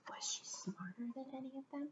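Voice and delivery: whispered question; whispered suspense question. Acted. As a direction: hush the kids with a whisper. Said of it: "storyteller suspense".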